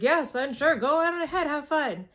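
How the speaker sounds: high, loud, breezy dad voice; booming go-ahead to the kid; cheery dad permission. Retold or imitated. Dad telling son to have fun, cheery and loud.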